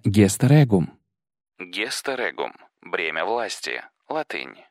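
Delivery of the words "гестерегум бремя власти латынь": much softer translator's note; footnote aside. A narrator's reading. Narrator explains a Latin title to listeners, soft footnote aside. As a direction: read it as a hushed aside.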